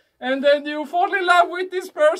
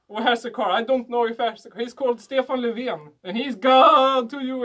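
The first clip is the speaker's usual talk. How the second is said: normal talking voice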